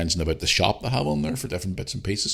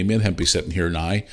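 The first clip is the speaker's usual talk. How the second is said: ordinary delivery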